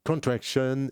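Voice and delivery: English terms in a deliberate English accent, drawn out; slow down and put on your English accent. Shown as a demonstration. Trader naming the volatility pattern for viewers, English accent. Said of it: "je prends mon accent anglais".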